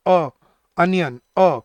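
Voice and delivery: "अ" sounded alone either side of "अनियन"; isolated opening vowel. Shown as a demonstration. Teacher showing students onion's first sound, "अ" isolated and firm. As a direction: separate "अ" as uh, the start of "अनियन". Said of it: "बोल के देखो".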